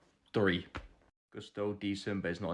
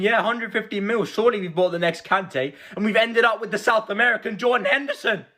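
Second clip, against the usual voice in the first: ordinary delivery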